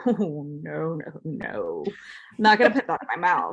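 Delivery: a touch of mock disgust; disgusted refusal. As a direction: recoil lightly from the glass. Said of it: "I've had wine where I'm like".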